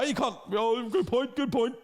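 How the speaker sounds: hammed-up Ballymena hard man swagger; Ballymena fighter impression. Fighter trash-talking UFC opponents, in a Ballymena accent, hammed up. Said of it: "that's a pretty good Reece impersonation".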